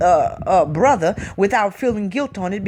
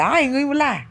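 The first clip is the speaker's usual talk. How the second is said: normal talking voice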